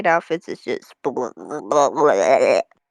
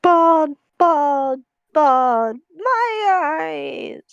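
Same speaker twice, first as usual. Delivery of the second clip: anguished wail; 7 semitones higher, much slower than usual. Real, not acted. Reacting in horror to bald emotes.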